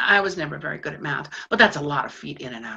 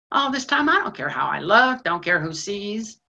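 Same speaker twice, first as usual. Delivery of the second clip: ordinary talk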